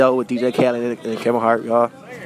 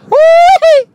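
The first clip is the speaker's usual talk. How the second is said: high loud woo-wee whoop; whooping to hype the song; 11 dB louder than usual. Real, not acted. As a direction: let out a big whoop. Hyping his karaoke song.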